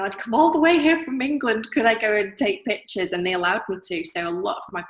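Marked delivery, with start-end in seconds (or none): 0.0-3.1 put-on posh English accent, pitched up and louder; playing the polite English visitor asking a favour; put-on British accent. Artist retelling how she talked her way into the shop, in a put-on British accent. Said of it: "my best British accent".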